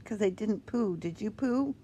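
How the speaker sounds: usual speaking voice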